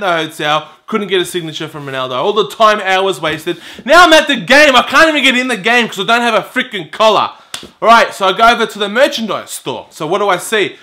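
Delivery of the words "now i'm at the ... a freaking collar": loud, high exasperated rant; worked-up complaint, starting loud and high; livid. Retold or imitated. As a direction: burst out in raised-voice frustration. Retold fury at being shut out.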